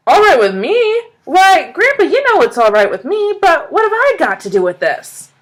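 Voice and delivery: high, loud, astonished questions; can hardly believe it; excited disbelief. A narrator's reading. Billy answering grandpa in amazed excitement. Boy dumbfounded and thrilled.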